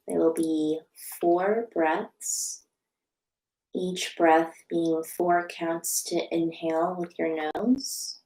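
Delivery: slow, soft meditation voice with long pauses; guided meditation. Real, not acted. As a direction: guide them gently into stillness, unhurried and hushed.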